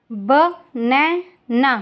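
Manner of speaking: very slow, stretched बनैना; slow careful model word. Shown as a demonstration. Teacher modelling बनैना slowly for listening pupils. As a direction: draw out बनैना for the class. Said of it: "listen to your teacher say the following words".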